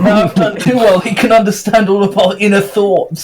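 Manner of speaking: high laughing wail. Real, not acted. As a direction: laugh through the lament. Mock-lament through laughter.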